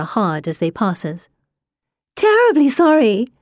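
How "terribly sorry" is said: high, insincere sing-song sorry; mock apology, hiding a laugh; fake apology. A narrator's reading.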